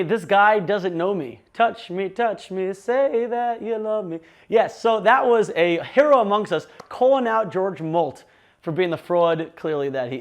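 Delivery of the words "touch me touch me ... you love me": mocking imitation.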